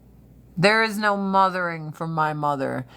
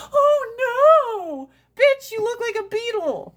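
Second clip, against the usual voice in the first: much higher, louder, with a mock cry on oh no; mock dramatic; 4 dB louder than usual. Shown as a demonstration. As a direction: overdo the shocked friend. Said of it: "and going".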